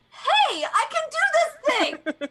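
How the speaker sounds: high, loud mock-heroic cry; hammed-up gung-ho shout through laughter; imitating an eager teammate. Teasing a teammate who ran outside, mock-eager shout. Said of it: "you were like".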